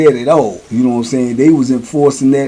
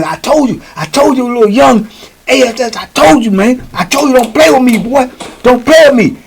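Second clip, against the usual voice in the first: higher, taunting; gloating old head; 9 semitones higher than usual. Retold or imitated. Old head taunting the burned young boy, higher. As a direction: rub it in. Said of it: "He said".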